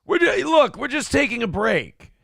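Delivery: high, sheepish fake excuse; squeaky mock breakup excuse. Mocking Vivek breakup excuse.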